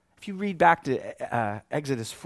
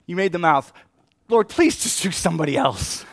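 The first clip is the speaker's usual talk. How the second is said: louder, strained begging; pleading Moses; 3 semitones higher, 8 dB louder than usual. Acted. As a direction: beg to be let off the job. Moses begging God to send another.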